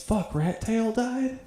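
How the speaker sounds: high, slow, imitated dismay; upset dad. Dad learns Rat Tail is dead, acted high-pitched upset. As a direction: stretch it out as an upset dad's stunned question. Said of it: "he was kind of upset".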